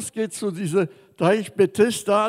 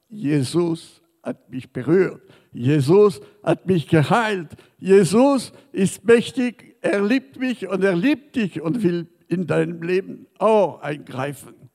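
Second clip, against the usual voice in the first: bold testimony; 5 dB louder than usual. Acted. Preaching testimony at full force.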